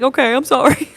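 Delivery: high, loud whimpering apology; mock tearful apology. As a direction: give in with a hammed-up sob. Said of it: "then I started crying".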